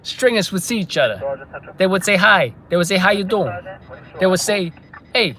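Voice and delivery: nostalgic Brooklyn wise guy; put-on Brooklyn accent. Stringer recalling respect back then, put-on Brooklyn accent. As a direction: lament the old days in Brooklynese. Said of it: "Brooklyn accent".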